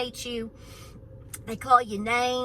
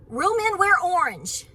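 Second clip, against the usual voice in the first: higher, louder, slow teasing boast; playful boast; 7 semitones higher, 6 dB louder, slower than usual. Real, not acted. Fan teasing rival viewers, slow and loud. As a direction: tease the Alabama fans. Teasing fans of other teams.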